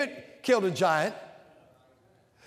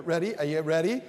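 normal talking voice

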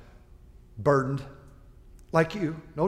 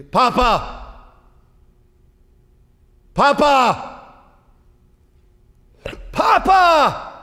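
high, louder and louder calling; imitating a little grandson shouting for Papa; child calling out; 12 semitones higher, 9 dB louder, much slower than usual. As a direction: call for grandpa, growing more desperate.

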